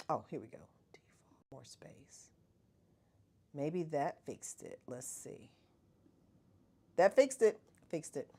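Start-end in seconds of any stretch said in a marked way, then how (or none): 0.1-5.5 unvoiced whispered reading; whisper to self. Real, not acted. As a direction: whisper the menu names to yourself. Muttering while fixing settings.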